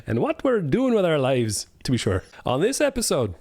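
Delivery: put-on lilting, swooping Irish accent. Host asks what the episode covers, in an Irish accent. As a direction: do the intro with an Irish lilt. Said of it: "I'll do Irish".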